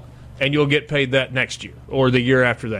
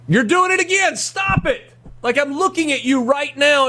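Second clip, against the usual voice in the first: playful scolding; 11 semitones higher than usual. Real, not acted. Teasing co-host for yawning.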